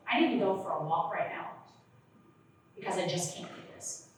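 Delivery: soft, fading to a whisper; quiet calm exit line. Shown as a demonstration. Teen telling mom they need a walk, quietly. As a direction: gently excuse yourself. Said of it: "I want you to say to her".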